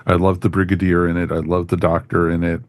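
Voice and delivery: normal talking voice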